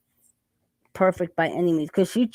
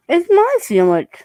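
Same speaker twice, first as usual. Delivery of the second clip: playful protest; 10 semitones higher, 7 dB louder than usual. Real, not acted. Playful protest over food.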